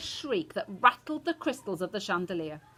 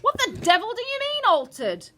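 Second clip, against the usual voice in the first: high, loud blustering disbelief; angry father demanding an explanation; outraged demand; 8 dB louder than usual. A narrator's reading. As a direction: burst out indignantly. Outraged father in read quarrel.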